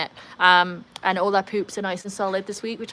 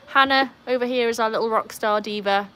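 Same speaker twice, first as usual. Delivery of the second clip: usual speaking voice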